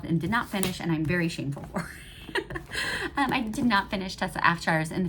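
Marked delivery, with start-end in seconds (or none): 1.9-3.1 breathy sheepish laugh; little guilty laugh; embarrassed giggle. Real, not acted. Shameful about unfinished books.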